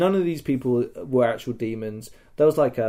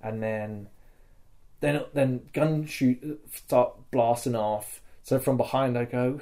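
ordinary talk, nothing marked

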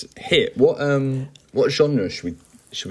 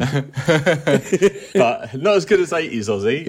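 laughing; 5 semitones higher, slower than usual. Real, not acted. Laughing while answering.

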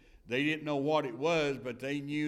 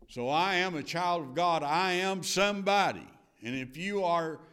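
preaching at full force; 5 semitones higher than usual. Acted. Preacher proclaiming to the congregation, triumphant.

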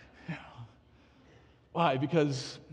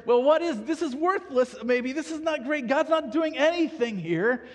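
higher, louder exasperated complaint; grumbling doubter; 6 semitones higher, 5 dB louder than usual. Retold or imitated. Believer complaining to God, exasperated and louder. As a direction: whine that this is worthless.

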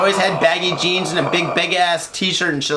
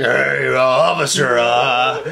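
lower, scruffy man's drawl; 5 semitones lower, slower than usual. Acted. Unshaved man in a dress hailing an officer, scruffy voice. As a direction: play an unshaven bear of a man, low. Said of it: "with a scruffy voice".

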